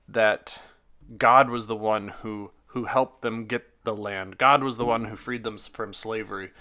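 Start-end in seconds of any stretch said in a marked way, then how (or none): none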